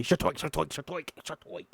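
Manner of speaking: quick run of popping shtoinks; cartoon pops. Reading the script, voicing a chain of popping plants. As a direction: pop them off like balloons. Repeated sound effect.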